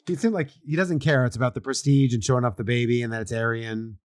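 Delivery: usual speaking voice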